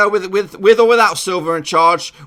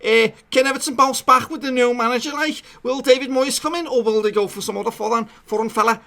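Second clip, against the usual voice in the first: high-pitched Scouse accent impression; 4 semitones higher than usual. Vlogger imitating an Everton fan asking about the new manager, Scouse accent. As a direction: put on your best Scouse. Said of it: "That's my best Scouse accent".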